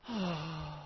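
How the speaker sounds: long, soft sigh of annoyance; exasperated sigh. Pretend. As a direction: huff out the air like a fed-up kid. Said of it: "You're not supposed to sigh out".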